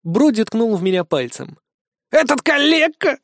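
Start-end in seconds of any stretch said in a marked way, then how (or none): 2.1-3.2 shocked scorn pitched up; astonished. A narrator's reading. Iggy stunned the cripple is accused, high astonished cry. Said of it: "поразился Игги".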